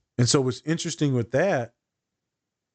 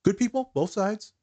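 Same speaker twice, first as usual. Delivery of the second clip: high, mock-earnest sarcasm; sarcastic; 7 semitones higher than usual. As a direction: parrot it with heavy irony. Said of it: "That's sarcasm".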